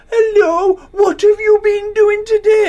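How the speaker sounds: put-on high cooing baby voice, slowed; cooing cat talk. Mocking wife cooing at the new cat. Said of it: "people go up to a cat and go".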